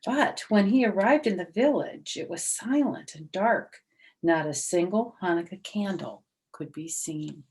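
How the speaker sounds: lively storybook reading voice; story narration. A narrator's reading. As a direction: read it out like a children's story. Reads story aloud.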